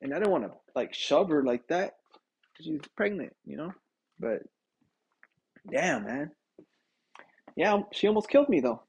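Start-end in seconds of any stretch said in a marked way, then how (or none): none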